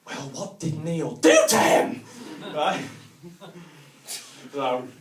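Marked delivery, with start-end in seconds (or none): 0.0-2.0 put-on loud, slow outraged kid; indignant child. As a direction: demand it like a cross little boy.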